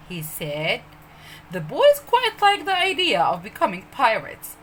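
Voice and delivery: usual speaking voice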